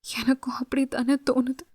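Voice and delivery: tearful whispered admission. Acted. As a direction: breathe it out unvoiced, close to him. Tearful woman's soft admission. (கண்ணீருடன் கிசுகிசுப்பு)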